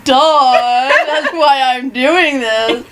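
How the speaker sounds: mock duh. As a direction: hammer the obvious like a smug boyfriend. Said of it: "And he'd be like".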